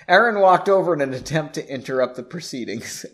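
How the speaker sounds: usual speaking voice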